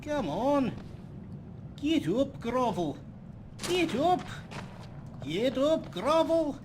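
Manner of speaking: hammed-up cheer to get up; mock cheering. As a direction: egg him on like a sports parent. Cheering on a fallen companion.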